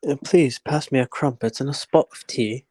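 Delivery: a posh British accent played for laughs; mock British accent. Joking about the colonel, in a mock British accent. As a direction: order tea like a caricature Englishman.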